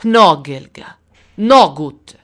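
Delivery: loud, scornful rejection; contemptuous. A narrator's reading. Russian soldier rejecting the girl, contemptuous. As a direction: spit out the verdict. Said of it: "ripeté sprezzante".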